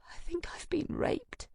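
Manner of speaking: hushed whisper; murmur. A narrator's reading. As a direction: breathe the words out. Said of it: "I murmur".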